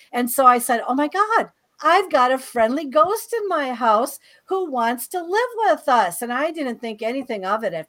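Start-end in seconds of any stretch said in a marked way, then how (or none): 0.9-6.2 re-enacted delighted surprise, higher pitch; excited. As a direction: gush a little, like greeting a friendly discovery. Acting out her delighted first reaction.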